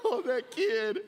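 high, loud, laughing sob voice; hammed-up teary sigh over the kid; mock tearful dad. Dad retelling his teary reaction to congregation, mock weepy. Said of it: "I'm like".

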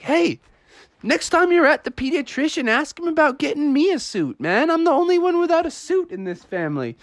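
high-pitched put-upon dad; complaining dad. Acted. Dad left without a suit complains to family, complaining. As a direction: whine it high, hurrying at the end. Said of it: "Froggy's dad was like".

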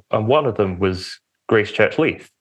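ordinary talk, nothing marked

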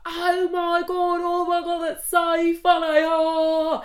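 loud, high, drawn-out mock hilarity; hammed-up English accent, helplessly amused; over-amused gushing. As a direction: imitate someone overreacting to a joke. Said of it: "He laughed at everything he said".

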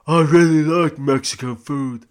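loud, high, bad film-star impression; Sly. Host mimicking the actor at dinner, loud bad impression. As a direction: belt out a hammed-up action-hero voice. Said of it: "that was me doing a Sly voice".

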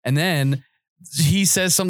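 normal talking voice

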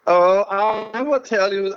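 ordinary talk

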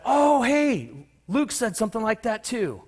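high-pitched, loud "oh, hey" of recognition; pleasant surprise. Acted. Congregation noticing Luke said it too, pleasantly surprised. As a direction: perk up high as if spotting something familiar.

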